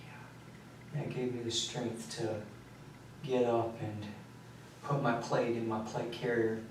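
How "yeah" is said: a voiceless, spent whisper; just breath, drained and done; whispering back to Michael, drained; whispered yeah. Retold or imitated. Weary agreement after the killings.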